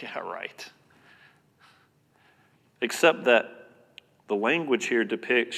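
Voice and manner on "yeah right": low, soft dismissive aside; eye-rolling teenager. Acted. Preacher imitates a teenager's response, quiet eye-rolling scepticism. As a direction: mutter it under your breath with an eye roll. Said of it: "a teenager rolls their eyes".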